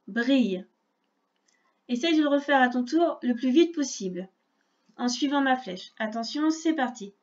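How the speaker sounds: usual speaking voice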